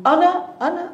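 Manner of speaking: high, loud displeased echo; retold displeasure. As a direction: repeat it back pointedly, disapproving. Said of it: "Seolah Nabi sallallahu alaihi wasallam nggak suka". (menirukan rasa tidak suka, nada tinggi)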